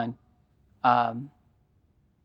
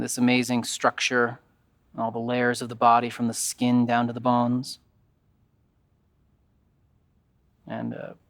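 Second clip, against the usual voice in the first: calm, even yoga nidra guidance with long pauses; guided meditation. Acted.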